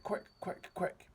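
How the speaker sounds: quick breathy urging; urgent whisper. A narrator's reading. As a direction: hurry him in a hushed rush. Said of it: "he cried".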